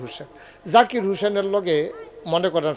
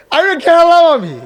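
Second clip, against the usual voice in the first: high, loud mocking cry; mock shout; 13 semitones higher, 11 dB louder than usual. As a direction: jeer it like a crowd of scoffers. Mocking the claim loudly.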